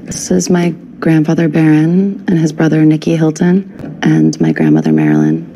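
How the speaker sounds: ordinary talk, nothing marked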